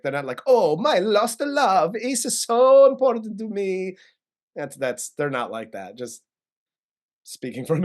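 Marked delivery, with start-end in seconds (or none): 0.5-4.0 higher and louder, hammed-up swooning sigh; mock lovesick romantic. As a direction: swoon over a lost love, overdone.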